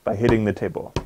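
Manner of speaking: usual speaking voice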